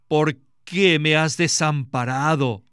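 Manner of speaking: usual speaking voice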